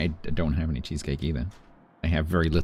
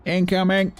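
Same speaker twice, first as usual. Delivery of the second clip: excited call; 12 semitones higher, 5 dB louder than usual. Acted. Game alert, calling out.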